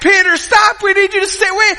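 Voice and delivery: high, loud retold holler; calling out urgently. Dad yelling to his other son ahead, high urgent call. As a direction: call after him in mock alarm.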